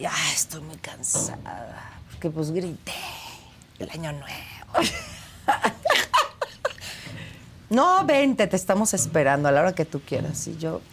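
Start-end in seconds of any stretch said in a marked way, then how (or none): 0.1-4.9 fake tired voice. Excusing herself on the phone to the producers, faked weary whisper. 5.5-7.2 laugh. Real, not acted. Laughs at her own excuse.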